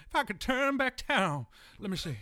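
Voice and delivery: Cher. Acted. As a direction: do a cheesy radio impersonation of a singing star. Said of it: "morning radio bullshit impersonations".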